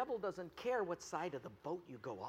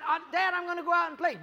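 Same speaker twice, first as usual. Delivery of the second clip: put-on high, loud, quick kid's protest; voiced young son; 10 semitones higher, 8 dB louder, faster than usual. Son tells dad he's off to play, high quick protest. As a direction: announce it fast and high to Dad.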